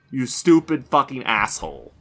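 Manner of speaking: loud, forceful cursing; real anger breaking out; angry insult. Real, not acted. Player fuming at teammate's death.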